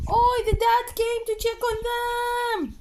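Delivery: high and slow, doting. Real, not acted. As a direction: marvel at the dad in a high sing-song. Baby talk to the pets.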